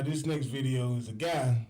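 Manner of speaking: normal talking voice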